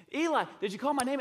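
fast, high, loud question; Samuel. Retold or imitated. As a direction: burst in calling out urgently. Third frantic call to Eli.

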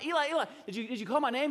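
high, loud, urgent calling out; Samuel. Retold or imitated. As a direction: act a boy bursting in to ask. Boy runs again calling Eli.

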